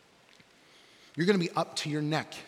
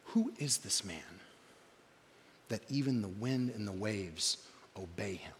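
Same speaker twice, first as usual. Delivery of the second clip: hushed amazement; 7 semitones lower, 9 dB quieter than usual. Acted. Disciples after the storm calms, hushed and amazed. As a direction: fade to a near whisper in wonder. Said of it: "The disciples were amazed".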